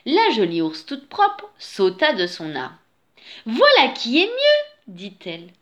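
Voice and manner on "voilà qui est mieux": very high, loud, pleased exclamation; delighted she-bear. A narrator's reading. She-bear approves of the freshly washed bear, delighted. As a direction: cheer the result in a high character voice.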